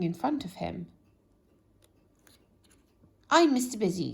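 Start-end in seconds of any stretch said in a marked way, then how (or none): 3.3-4.1 louder, brisk self-introduction. Acted. Mister Busy introducing himself to Lazy, brisk and loud. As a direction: announce yourself briskly. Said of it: "said one of the men".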